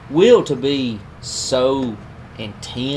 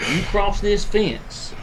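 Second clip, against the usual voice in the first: slow, drawn-out warning, pitched up; measured, higher-pitched retold challenge; retold threat; 6 semitones higher, much slower than usual. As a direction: lay down a line slowly, imitating your own threat.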